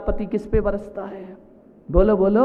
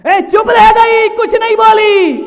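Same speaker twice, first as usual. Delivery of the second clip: thundering repeat; 12 semitones higher, 12 dB louder than usual. Real, not acted. Preacher stressing the wife's silence, shouted. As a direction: bellow "चुप रह गई" at full force. Same words thundered after pause.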